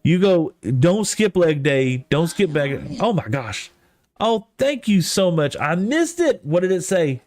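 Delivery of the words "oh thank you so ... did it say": ordinary delivery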